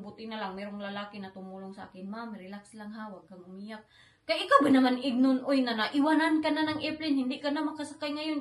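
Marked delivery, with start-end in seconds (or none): 2.1-3.9 comforting. Retold or imitated. Kind stranger voiced softly. 4.3-8.4 exasperated. Real, not acted. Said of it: "exasperated outburst to listeners".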